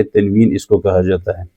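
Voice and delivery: usual speaking voice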